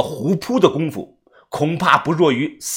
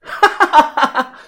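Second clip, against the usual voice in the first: high mocking cackle; big scornful high laughter; loud laugh; 9 semitones higher than usual. A narrator's reading. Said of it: "小道士大笑".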